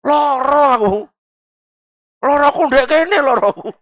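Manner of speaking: whining sick complainer. Acted. Feigning illness to get out of fasting, whiny. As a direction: moan about the pain in a put-on whimper.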